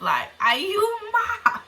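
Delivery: high, slow, stretched "are you mad"; incredulous. Retold or imitated. Asking the girl in disbelief, high drawn-out challenge. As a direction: draw out the disbelief. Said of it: "repeats question with more force".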